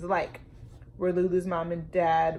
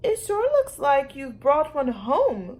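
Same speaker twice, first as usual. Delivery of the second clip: higher, louder and slow; mom's dry remark; 6 semitones higher, 6 dB louder, slower than usual. A narrator's reading. Mom to Lulu, higher, louder, slow and wry. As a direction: tease her daughter like a wry mother. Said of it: "Lulu's mom said to Lulu".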